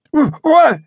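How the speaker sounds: high, loud panicked stammer; a drunk man caught off guard, blurting; startled blather. A narrator's reading. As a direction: ventriloquist grabbed in the dark, startled high stammer. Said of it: "he blathered".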